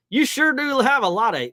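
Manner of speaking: put-on country accent. Retelling friend's remark, in a mock country accent. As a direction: put on a hammed-up country twang. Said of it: "I put on a country accent".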